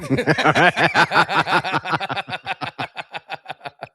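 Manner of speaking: long high laughing fit; loud laughter. Real, not acted. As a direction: burst out laughing. Big laugh after reply.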